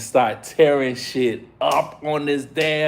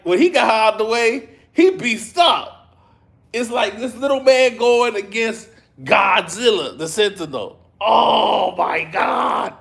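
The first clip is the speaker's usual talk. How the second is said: awed; 6 semitones higher than usual. Real, not acted. Awed by Gambit vs Sentinel.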